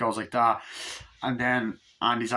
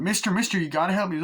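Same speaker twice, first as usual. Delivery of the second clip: put-on higher scared kid voice; 7 semitones higher, faster than usual. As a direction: panicky boy pleading, higher. Scared boy begs for help.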